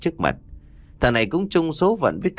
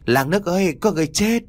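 calling out in alarm; 7 semitones higher, faster than usual. A narrator's reading. Two crab catchers running home shout to the whole village, panicked. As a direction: shout the alarm as you run in. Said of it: "miệng bải hoài kêu lớn".

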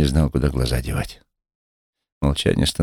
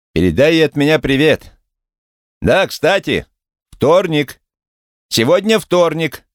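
raised, lively female voice; higher character voice; 11 semitones higher, 4 dB louder, slower than usual. Acted. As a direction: call it out brightly. Character voice, high, loud.

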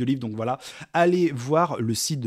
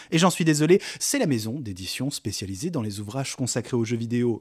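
ordinary talk, nothing marked

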